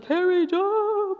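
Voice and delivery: high, drawn-out fanfare of a name; stretched, soaring announcer-style hype; grand star billing. Shown as a demonstration. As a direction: sing out the name like a big star. Star name versus ordinary name.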